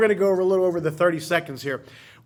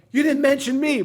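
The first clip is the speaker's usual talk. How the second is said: higher-pitched imitated protest; mock complaint; 7 semitones higher, 3 dB louder than usual. Retelling Linda's complaint about the wedding video, high mock protest.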